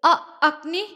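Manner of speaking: name catches in her throat; voice breaking. A narrator's reading. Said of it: "ജ്വാലയുടെ സ്വരമിടറി". (ഇടറിയ സ്വരം)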